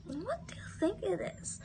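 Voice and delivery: hushed whisper of the question. Acted. Teacher whispers the quiz question to pupils, whispering. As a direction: lean in and whisper it like a secret.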